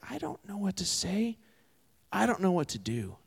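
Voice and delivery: small, high, quiet admission of being lost; at a loss. Retold or imitated. Preacher voicing people stuck in hard situations, softly helpless.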